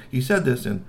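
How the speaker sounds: ordinary delivery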